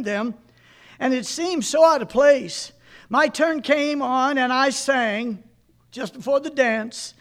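put-on reading an old sermon aloud to a congregation. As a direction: read it as a preacher retelling his own story.

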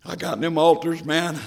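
ordinary talk, nothing marked

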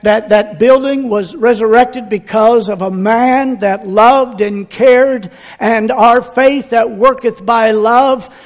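rolling preacher's cadence with loud punches; full-force preaching. Acted. Pastor rallying the congregation: full-force preaching. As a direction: preach it out, pounding the key words.

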